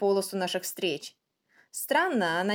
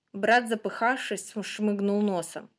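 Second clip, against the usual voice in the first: ordinary delivery, nothing marked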